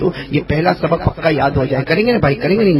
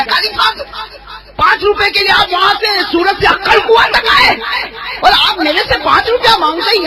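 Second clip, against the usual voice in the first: Rashid Khan indignant; 13 semitones higher than usual. Retold or imitated. Preacher imitating Rashid Khan scolding him, hammed-up indignant shout. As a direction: scoff at the petty request, voice thrown up high. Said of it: "Rashid Khan کیا کہے گا؟".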